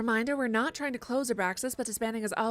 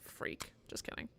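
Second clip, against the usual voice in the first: much softer breathy aside; whisper; 8 semitones lower, 14 dB quieter than usual. Real, not acted. Player whispering a teasing insult. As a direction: whisper a tease.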